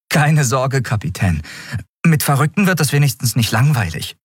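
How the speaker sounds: put-on young crewman jokes with his captain; cheeky young Miko. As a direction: tease her a little, playful boy.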